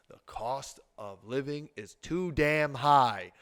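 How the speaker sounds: measured and weighty, pausing between phrases; slow, pointed delivery of the key finding; slow, deliberate emphasis. Real, not acted. Key finding, said slowly then repeated louder.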